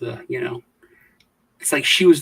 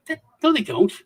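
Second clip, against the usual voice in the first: insistent denial; 9 semitones higher than usual. Retold or imitated. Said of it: "I was like".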